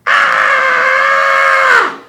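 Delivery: high piercing shriek of terror; terrified scream. Acted. Wiley facing the hairy man, terrified shriek. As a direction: shriek in sheer fright.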